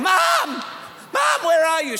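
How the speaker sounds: put-on high-pitched kid calling for Mom; acted worried shout through the house; anxious calling out. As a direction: call out, searching for your mother. Calling for missing mum as child.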